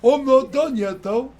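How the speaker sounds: high, loud, slow whiny drawl; Bastian voice. Acted. Bastian whines back that he is not done. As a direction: whine like a lazy oaf. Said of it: "There it is".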